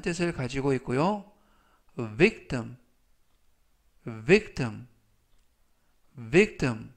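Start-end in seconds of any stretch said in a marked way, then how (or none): 2.2-6.9 victim said crisply three times, with pauses to repeat; careful pronunciation demo. Shown as a demonstration. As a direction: model victim clearly for the class. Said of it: "이라고 발음하시면 되겠습니다". (victim을 또박또박 세 번 발음 시범)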